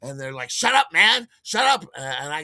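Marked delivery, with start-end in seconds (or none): 0.5-1.8 high, loud urgent command; agitated order. Acted. Agitated dealers silencing the screaming buyer, loud order. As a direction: yell at him to stop.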